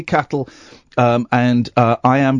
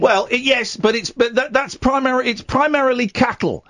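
flustered; 7 semitones higher than usual. Acted. Flustered high-pitched explanation.

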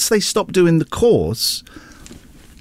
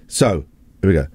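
normal talking voice

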